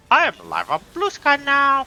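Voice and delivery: high, put-on announcement voice of a bot; robotic bot voice. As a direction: chirp it like an automated post. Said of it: "automated message say".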